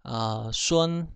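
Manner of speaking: ordinary delivery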